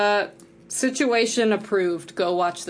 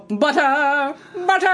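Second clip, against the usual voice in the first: much higher, excited put-on yelp; mock cartoon cry; 7 semitones higher, 3 dB louder, slower than usual. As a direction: shout it like a giddy tea-party loon. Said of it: "and then they go".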